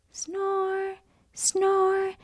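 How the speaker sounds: soft high snore sounds; snoring. Acted. George fast asleep, snoring softly. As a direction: breathe out two little snores.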